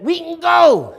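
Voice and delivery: high, loud imitated shout; excited call-out. Somebody in class announces they can leave, high imitated shout. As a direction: holler it out, mimicking a classmate.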